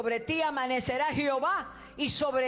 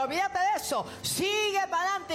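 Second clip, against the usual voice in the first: preaching; 7 semitones higher than usual. Acted. Exhorting congregation higher.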